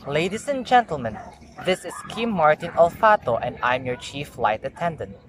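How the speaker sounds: ordinary delivery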